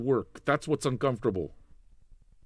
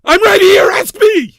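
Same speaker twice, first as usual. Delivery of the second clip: a much higher, much louder yell; furious shout; 16 dB louder, slower than usual. Real, not acted. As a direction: scream it so they can't ignore you. Yelling at chatter.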